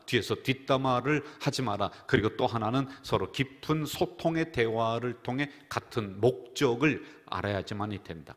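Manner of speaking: ordinary talk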